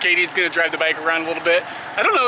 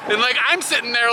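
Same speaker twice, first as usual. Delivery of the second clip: normal talking voice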